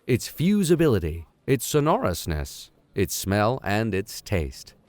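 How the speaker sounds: measured storybook reading voice; story narration. A narrator's reading. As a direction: read it like a classic adventure novel. Reads novel aloud as narrator.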